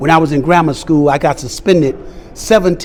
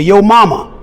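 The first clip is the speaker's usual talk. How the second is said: louder, higher mock taunt; 4 semitones higher, 5 dB louder than usual. Bully goads the kid into a fight, taunting. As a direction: throw it out as a playground provocation.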